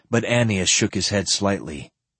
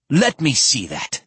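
snapping; 6 semitones higher than usual. Acted. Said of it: "Annius snapped".

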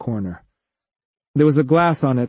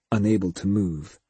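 ordinary delivery, nothing marked

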